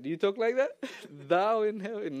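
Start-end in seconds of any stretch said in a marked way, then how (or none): 1.3-2.2 mock King James. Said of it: "that's King James right there".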